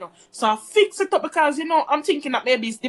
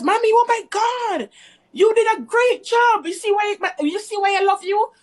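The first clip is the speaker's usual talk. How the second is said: put-on boyfriend gushing; 8 semitones higher than usual. Quoting her man praising her cleaning, high and gushing. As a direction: gush like he's overwhelmed.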